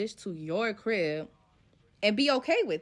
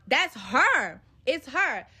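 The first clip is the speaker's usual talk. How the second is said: ordinary talk, nothing marked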